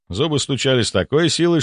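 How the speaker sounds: plain reading voice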